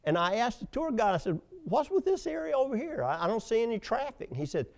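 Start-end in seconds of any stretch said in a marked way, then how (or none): 1.6-4.3 puzzled tourist. Retold or imitated. Retells asking the tour guide.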